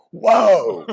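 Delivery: sudden high, loud startled cry; startled whoa. Retold or imitated. Startled cry as the dog lunges at the vet. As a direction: jump back with a sharp whoa. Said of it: "I was like".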